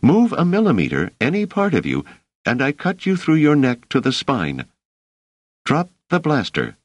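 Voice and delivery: high, tense, emphatic threat; threatening woman. A narrator's reading. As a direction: give orders at knifepoint. Woman threatens man at knifepoint.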